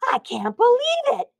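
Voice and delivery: high, loud shocked gasp; a donut learning donuts are meant to be eaten. A narrator's reading. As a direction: gasp it out in disbelief. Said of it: "Arnie gasped".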